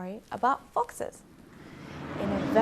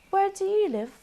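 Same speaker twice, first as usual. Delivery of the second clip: fox asking; 12 semitones higher, 5 dB louder than usual. Acted. Fox asks frog.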